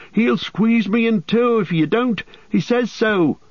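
very high-pitched, frantic warning; panicked cry. A narrator's reading. Merry warning the tree will crush him, frantic and high. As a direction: plead desperately, pitched right up. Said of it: "cried Merry".

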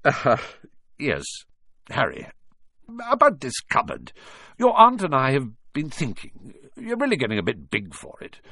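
halting, strained friendliness; forced niceness. A narrator's reading. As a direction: feign kindness through a painful smile. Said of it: "forced his face into a smile which looked quite painful".

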